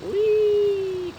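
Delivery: high, loud delighted squeal; gleeful whee. Real, not acted. Gamer cheering as the machine takes off, gleeful. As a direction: let out a joyful whoop. Cheering while driving in game.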